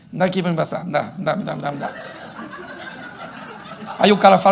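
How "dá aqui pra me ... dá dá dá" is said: soft, low grumbling; muttered, grudging compliance trailing off; grudging wife. Acted. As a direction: grumble under your breath as the wife. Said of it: "a mulher diz assim".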